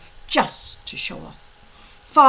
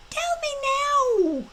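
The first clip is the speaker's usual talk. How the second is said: high, petulant dragon voice; demanding little dragon; much faster than usual. A narrator's reading. Small dragon demanding Hiccup's jokes right now. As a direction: demand it like a spoilt pet.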